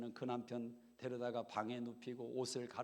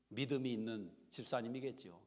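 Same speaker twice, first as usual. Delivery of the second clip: ordinary talk, nothing marked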